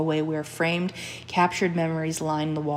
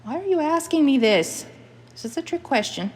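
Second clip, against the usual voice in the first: mother's Scottish voice jumping much higher and louder; a wary Scottish mother, pitched up high in alarm; suspicious mother; 10 semitones higher, 3 dB louder than usual. Acted. The mother suspects her daughter's question is a trick, in a high, loud Scottish voice.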